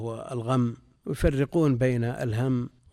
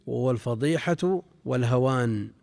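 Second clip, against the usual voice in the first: ordinary talk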